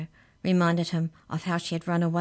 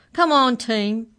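slow, high, loud call; rally the pack with a drawn-out shout; 7 semitones higher, 9 dB louder, slower than usual. Acted. Canine leader rallies the pack.

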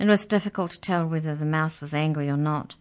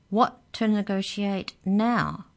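slow, higher-pitched demand; 6 semitones higher, 3 dB louder, slower than usual. A narrator's reading. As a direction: press for an answer, drawn out. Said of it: "demanded Mallory".

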